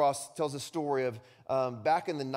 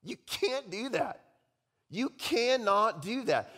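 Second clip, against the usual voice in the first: high, slow, emphatic objection; world's protest; 9 semitones higher, 3 dB louder, slower than usual. Acted. Preacher voices the world forbidding self-denial, emphatic protest. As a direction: object slowly, pitched well up. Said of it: "the world says".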